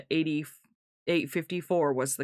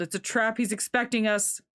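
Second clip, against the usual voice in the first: high-pitched urgent alarm; yelled warning; 7 semitones higher than usual. A narrator's reading. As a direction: raise the pitch to warn the others. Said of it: "I yelled".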